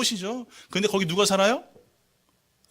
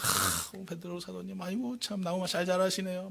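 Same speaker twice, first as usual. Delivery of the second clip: sweet praise; 9 dB quieter than usual. Pretend. Sweet talk to the tree.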